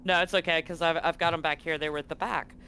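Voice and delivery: usual speaking voice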